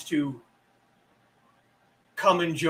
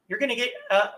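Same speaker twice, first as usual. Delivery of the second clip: ordinary delivery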